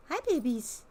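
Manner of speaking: high sweet coo to pets; cooing at the raccoons. Real, not acted. Talking to her pet raccoons in a cooing voice. Greeting raccoons, baby talk.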